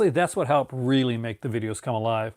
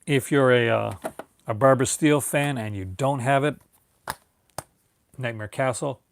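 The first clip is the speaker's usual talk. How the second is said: ordinary delivery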